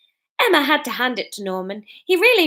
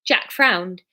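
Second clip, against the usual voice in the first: ordinary delivery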